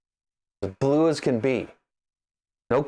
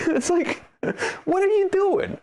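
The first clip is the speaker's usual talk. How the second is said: very high, squeaky disbelief; exasperated high-pitched protest with a laugh; incredulous. Acted. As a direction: voice shooting up in mock bafflement. Incredulous question to the company.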